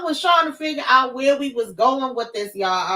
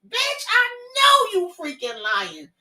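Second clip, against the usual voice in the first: outraged disbelief; 6 semitones higher than usual. Real, not acted. Outraged at the character.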